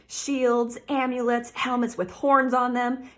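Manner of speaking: normal talking voice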